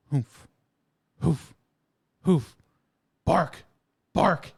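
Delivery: loud huffs building to barks; mock dog huffing. Dog warming up at the UPS truck, loud huffs then barks. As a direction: psych yourself up in huffs, then bark. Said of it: "he'll just go".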